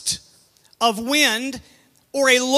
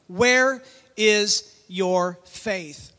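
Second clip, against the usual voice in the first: slow and low, word by word; slow, measured question; 4 semitones lower, much slower than usual. Retold or imitated. Jesus questioning his disciples after calming the storm, slow and measured. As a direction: space out each word for weight.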